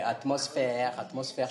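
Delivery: attempted old Parisian accent, stretched "atmosphère"; rough go at a Parisian film accent. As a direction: imperfectly imitate a Parisian grasseyé R. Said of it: "le R parisien".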